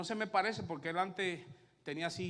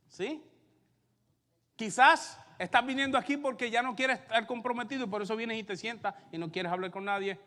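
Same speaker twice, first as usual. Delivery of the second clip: loud, higher preaching voice; fiery preaching; 8 semitones higher, 9 dB louder than usual. Acted. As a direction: drive each point home to the congregation. Preaching at full force.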